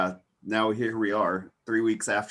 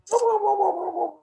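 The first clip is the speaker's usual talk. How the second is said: high gobble-gobble turkey call; gobbling turkey voice; 5 dB louder than usual. Shown as a demonstration. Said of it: "that was a turkey".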